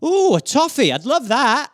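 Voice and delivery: high, pleased posh guest; high mock delight. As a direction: pick a toffee with delight. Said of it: "you go".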